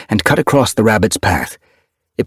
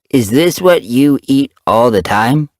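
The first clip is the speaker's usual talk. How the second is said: higher, louder, slow wary question; wary kitten; 6 semitones higher, 4 dB louder than usual. A narrator's reading. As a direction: ask it warily, afraid of the answer. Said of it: "he asked warily".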